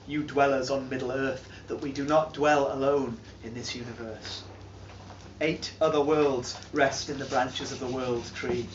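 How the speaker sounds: a hint of an ominous hush; hushed telling. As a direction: draw the listeners in close. Addressing audience in hushed tone.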